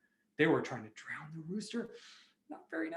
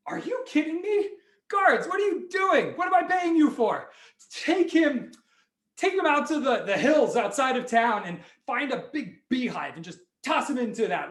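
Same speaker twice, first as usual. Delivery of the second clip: high, loud, hammed-up outrage; exasperated rant; 8 semitones higher, 6 dB louder than usual. King scolding guards he pays, exasperated shouting. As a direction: berate useless guards at the top of your voice.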